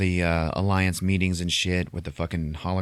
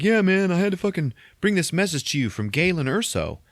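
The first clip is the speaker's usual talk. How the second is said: frantic pleading; 11 semitones higher, 3 dB louder than usual. Retold or imitated. Bodhi pleading to Saw.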